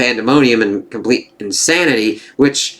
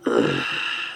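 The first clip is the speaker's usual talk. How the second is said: deep weary sigh; long exasperated sigh; 10 dB quieter than usual. Real, not acted. Fed up with the plot, a long weary sigh. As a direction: let all the air out, exasperated.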